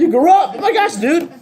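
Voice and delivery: high, loud exclamation with laughter; mock astonishment. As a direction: marvel loudly at how they've grown. Acts out shock at grown-up kid.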